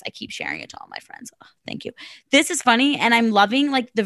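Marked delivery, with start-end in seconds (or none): 0.1-1.9 whisper. Real, not acted. Whispered end of letter.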